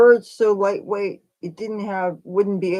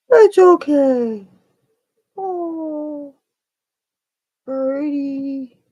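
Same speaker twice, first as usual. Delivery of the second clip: soothing a scared bird; 6 semitones higher, 3 dB louder, much slower than usual. Real, not acted. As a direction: coo gently to a frightened chick. Cooing to the bird.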